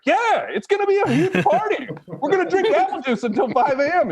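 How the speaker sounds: high, laughing excitement over his own joke; giggling as he pitches the party; laughing hype. Retold or imitated. Comic to the nurse, pitching his party through laughter. Hyping an all-night apple juice party.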